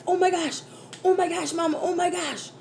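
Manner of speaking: put-on high-pitched child panicking; imitated freaked-out daughter. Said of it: "so she's freaking out".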